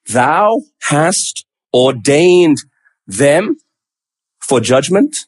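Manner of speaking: slow reading, "them" and "judgment" lifting like questions; questioning intonation. Acted. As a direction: read the statement slowly as a puzzled question. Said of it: "Can you hear the question in his voice?".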